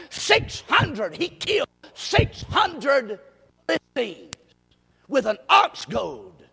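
excited pulpit tally, mounting to a shout; building preacher's count. Real, not acted.

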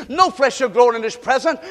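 ordinary talk, nothing marked

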